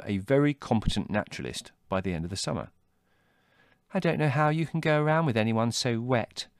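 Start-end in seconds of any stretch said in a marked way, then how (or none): 3.9-6.5 put-on higher, scornful girl's voice; disdainful sister. A narrator's reading. Margot scorning her brother's companion, disdainful. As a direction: sneer at her brother's friend. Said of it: "she said".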